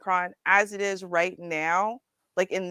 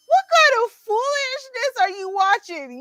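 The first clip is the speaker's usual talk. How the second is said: high-pitched imitation of baffled Irishmen; mock incredulous scolding; 3 dB louder than usual. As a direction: act out their disbelief, pitched way up. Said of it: "and they're like".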